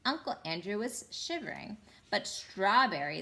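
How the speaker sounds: plain reading voice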